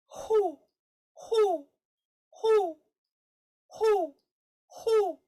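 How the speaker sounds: very high, loud falling hoots; big round owl hoots, evenly spaced. Shown as a demonstration. Teacher leading owl hoots for practice, owl hoot. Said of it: "hoot like an owl again".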